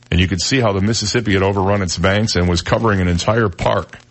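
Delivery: usual speaking voice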